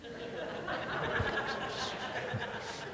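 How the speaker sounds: soft airy laugh; breathy chuckle at himself; quiet amused laugh. Real, not acted. Laughs at own story.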